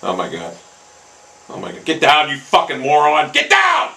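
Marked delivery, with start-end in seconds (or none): 1.8-3.9 angry yelling. Real, not acted. Gamer yelling at the ball, angry shout. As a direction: order it down with rising fury.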